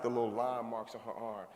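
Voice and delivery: usual speaking voice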